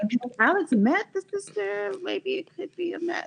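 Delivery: high, excited gasp of realization. Retold or imitated. A fan telling her cohost about getting carried away. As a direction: catch yourself hoping. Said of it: "And then I was like".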